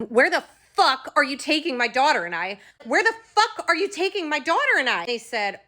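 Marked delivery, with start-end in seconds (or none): 0.1-2.6 raised, furious demand; angry yell. Retold or imitated. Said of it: "I started screaming at them". 2.8-5.1 much higher, furious scream; louder angry scream. Retold or imitated. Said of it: "I started screaming at them".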